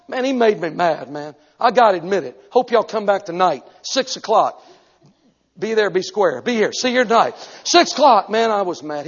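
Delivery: ordinary talk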